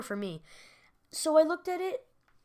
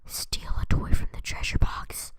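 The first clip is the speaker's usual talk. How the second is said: whisper; 8 dB louder than usual. Acted. Scheming kid whispers his plan.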